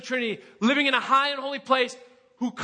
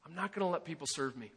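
muttered self-talk; 7 semitones lower, 12 dB quieter, faster than usual. Retold or imitated. Voicing the proud flesh.

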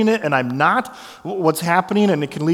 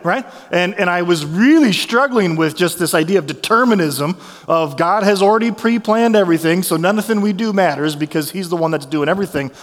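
ordinary talk

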